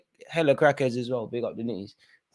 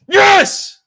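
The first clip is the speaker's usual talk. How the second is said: triumphant shout; 10 dB louder than usual. Real, not acted. VAR decision goes his way.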